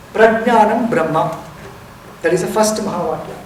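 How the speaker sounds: usual speaking voice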